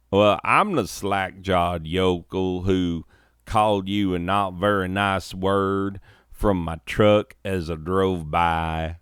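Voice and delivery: light country twang. Acted. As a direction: play the yokel with a touch of twang. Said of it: "put a little twang on this".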